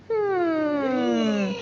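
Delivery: pondering hmm for the class; thinking hum. Shown as a demonstration. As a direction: show them a thinking hum. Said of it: "Everyone go like that".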